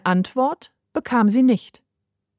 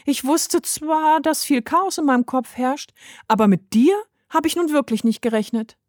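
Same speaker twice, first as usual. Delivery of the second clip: put-on little girl explaining; 6 semitones higher than usual. A narrator's reading. Heidi explains herself to the bird, high child voice.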